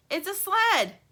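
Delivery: high, loud joyful shout; calling out excited. Acted. Lumpy shouts to friends while leaping, thrilled. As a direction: yell it with delight as he jumps. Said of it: "he calls".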